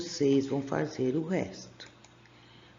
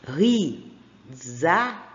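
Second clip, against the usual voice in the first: stretching every syllable; slow, drawn out; much slower than usual. Shown as a demonstration. Teacher sounds out the word for the class, slowly. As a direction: let the Z sound ring out. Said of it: "Som de Z".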